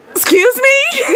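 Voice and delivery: high, sharp, indignant question; indignant shock. Retold or imitated. When the date calls the snake escape normal, high indignant shock. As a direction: pitch leaps up in offended disbelief.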